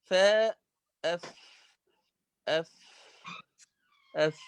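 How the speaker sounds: أف with the breath of the ف clearly audible; whispered letter demo. Shown as a demonstration. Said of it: "تجد هواء". (نطق الفاء الساكنة في أف بجريان النفس)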